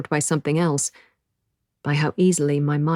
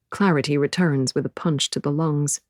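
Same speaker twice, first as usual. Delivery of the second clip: ordinary delivery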